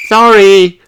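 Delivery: high, loud sorry blurted together. A narrator's reading. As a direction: shout it out at the same time. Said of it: "say Peppa and Susie together".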